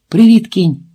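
high, loud eager hello; loud excited hi; bright greeting. Acted. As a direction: call out to him, thrilled. She greets him, heart pounding.

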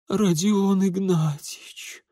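slow, high feeble wife; dying voice. A narrator's reading. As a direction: call your husband as if expiring. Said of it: "умирающим голосом пролепетала Полина Марковна".

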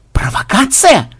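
high slow outraged echo; choking with rage. Acted. First xenobyte choking with rage at his double, high and slow. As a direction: splutter the word in fury. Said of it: "задохнулся от ярости первый ксенобайт".

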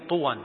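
"طوى" ending in an -an sound; tanween ending. Shown as a demonstration. Teacher showing the reading, "طوى" with tanween. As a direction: say "طوى" as tuwan, with final n. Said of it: "فيها تنوين".

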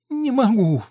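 high, tearful whine; whimpering, helpless complaint; whining. A narrator's reading. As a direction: draw it out plaintively. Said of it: "плаксиво протянула она".

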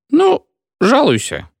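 put-on sleepy drunk doctor, high and slow; drunk feldsher, dismissive. A narrator's reading. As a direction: wave him off, slurring slowly.

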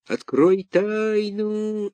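high, slow eerie wail; eldritch horror. A narrator's reading. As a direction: wail it like a ghost. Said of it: "опять завыл таинственный голос".

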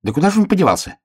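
высокая скороговорка себе под нос; недоумевай тоненьким голоском; бормочущий заяц. A narrator's reading. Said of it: "бормотал заяц". (заяц тараторит под нос)

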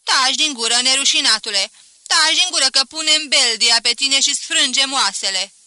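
outraged spinster threatens to break the servant's bones; furious shouting. Acted. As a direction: scream the threat to drive him off. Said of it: "îmi strigă Bondoaca".

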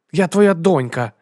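put-on high, loud little girl's shout; girl's indignant cry. A narrator's reading. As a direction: cry out like an offended daughter. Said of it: "крикнула дівчинка".

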